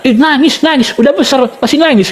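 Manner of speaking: mocking children's taunt. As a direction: tease like children at a crybaby, lightly acted. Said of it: "sampai mengejek saya".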